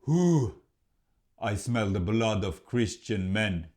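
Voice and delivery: growling troll sniffing the air; troll king. A narrator's reading. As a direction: sniff out the intruder like a hungry troll. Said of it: "he said".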